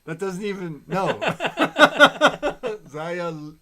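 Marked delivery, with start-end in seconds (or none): 1.1-2.8 high, loud cackle of real laughter; loud burst of laughter. Real, not acted. Laughing at pun.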